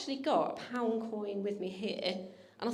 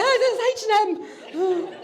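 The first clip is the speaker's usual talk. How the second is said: mock-scared squeak, higher and louder, slow; scared friends; 11 semitones higher, 10 dB louder, slower than usual. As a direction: play the frightened friends for laughs. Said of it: "shivered with fear and were just like".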